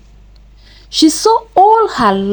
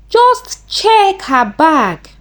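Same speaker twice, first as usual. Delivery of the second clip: slow, higher-pitched insisting; insistent; 7 semitones higher, slower than usual. A narrator's reading. Amanda urging Amaka to search the bag, insistent. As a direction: press the point, drawing the words out. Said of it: "Amanda insisted".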